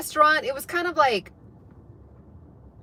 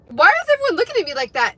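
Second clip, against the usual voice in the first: higher, louder, quick startled question; bewildered reaction; 6 semitones higher, 5 dB louder, faster than usual. Retold or imitated. Teller acting out herself noticing everyone's stares, bewildered and high. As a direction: blurt it out in puzzled alarm.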